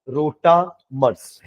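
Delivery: drawn-out, syllable-by-syllable term; slow term repetition. Real, not acted. Teacher repeats a new term to students, slowly and deliberately.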